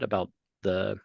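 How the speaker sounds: usual speaking voice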